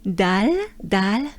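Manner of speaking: dal with a wide open A; open French dal. Shown as a demonstration. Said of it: "very open".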